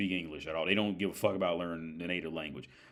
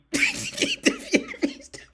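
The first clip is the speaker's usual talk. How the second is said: a loud, high laughing shriek; high cackle; 7 dB louder than usual. Real, not acted. Laughing at his own racist question.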